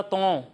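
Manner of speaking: तों with the vowel sent into the nose; wrong nasal Taa. Shown as a demonstration. Teacher repeating the pupil's mistake, a nasal तों. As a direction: say तों short, ending nasally. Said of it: "नाक में आवाज़ नहीं जाएगी".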